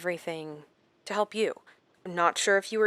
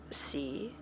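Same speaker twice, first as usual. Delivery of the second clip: softer, breathy whispered taunt; a gloating whisper to the captive; 3 semitones lower, 8 dB quieter than usual. Acted. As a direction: savor it under your breath. Doctor showing off scared patients.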